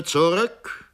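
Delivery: booming command, loud and high; thundering shout. Acted. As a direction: roar it as a threat. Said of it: "schrie mit donnernder Stimme".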